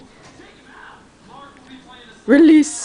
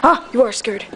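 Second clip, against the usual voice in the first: normal talking voice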